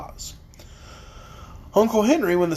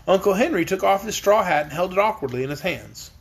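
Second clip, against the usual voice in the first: usual speaking voice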